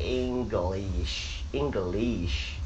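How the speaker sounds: "English" broken into slow syllables with a long "ee" before sh; students' mispronunciation. Retold or imitated. Teacher imitates students' mistake, "English" slow and over-syllabled. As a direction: drag "English" out as "ing-ga-leesh". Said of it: "很多的这个学生呢会发成说".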